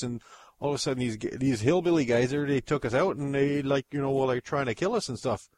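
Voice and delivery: Canadian dude pleading for help; kidnapped Canadian begging in a Canadian accent; Canadian alien. Acted.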